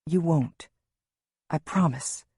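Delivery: steady, firm promise; understated, resolute comfort; firm reassurance. A narrator's reading. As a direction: promise it firmly, without wavering. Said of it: "Lewis says firmly".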